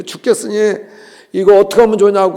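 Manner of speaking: loud, fast pleading cry; mock desperate prayer, high and loud; desperate complaint. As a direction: wail it out, fed up and desperate.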